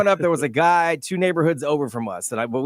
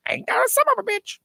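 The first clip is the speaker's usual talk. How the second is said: very high squawking parrot. Acted. Co-host's parrot repeats his favourite phrase, high squawk. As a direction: screech it like a cussing bird. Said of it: "my parent would say".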